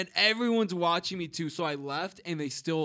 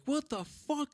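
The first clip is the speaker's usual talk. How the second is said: high-pitched mild mock scream; retold kids' screaming; 11 semitones higher, slower than usual. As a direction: imitate screaming kids without real volume. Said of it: "The kids start screaming".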